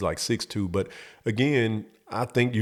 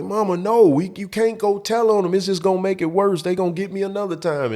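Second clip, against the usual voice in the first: loud pleading; 10 semitones higher, 3 dB louder than usual. Retold or imitated. Kid pleading with mom.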